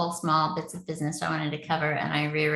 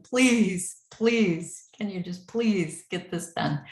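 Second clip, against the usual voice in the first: mock pleading; much slower than usual. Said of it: "like, pleading, begging".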